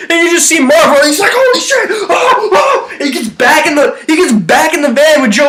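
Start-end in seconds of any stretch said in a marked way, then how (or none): 1.3-3.0 high panicked yelp; hammed-up high panic; Marv panicking. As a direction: shriek it in mock panic, pitched way up.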